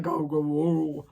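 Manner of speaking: slow, nasal goofy go go whoa; mock nasal honk. Vlogger mocking her own stuffy voice, slow goofy honk. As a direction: imitate a heavy Luann sound. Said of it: "I was like".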